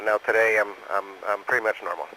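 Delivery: ordinary delivery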